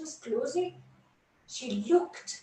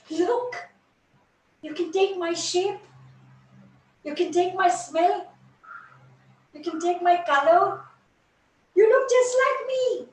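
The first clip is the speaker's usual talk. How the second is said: louder, rising, welcoming offer; generous offer; 4 semitones higher, 7 dB louder than usual. Acted. Jasmine warmly offering the dewdrop her shape and smell. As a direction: give it all gladly.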